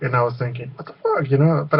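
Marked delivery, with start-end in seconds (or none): 0.8-1.3 retold disbelief. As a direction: act out a baffled thought in a squeak. Retold shocked private thought.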